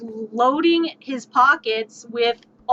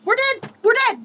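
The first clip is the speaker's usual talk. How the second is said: panicking; 8 semitones higher than usual. Real, not acted. Panicking at the dragon.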